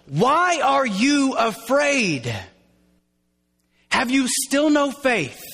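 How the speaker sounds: high, slow, emphatic rebuke; frustrated command. Retold or imitated. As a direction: demand it like a rhetorical question, every word stretched. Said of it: "with a command".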